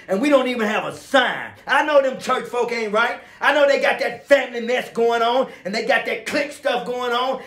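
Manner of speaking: high-pitched, impassioned preacher in full flow; fervent preaching. Real, not acted. Preacher vowing to forgive church folk, fervent preaching. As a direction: preach it hard, pitched well up.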